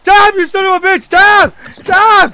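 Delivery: loud, high, punched-out yells; real gamer screaming; frantic demanding shout. Real, not acted. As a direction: yell at the opponent to submit. Demanding opponent taps out.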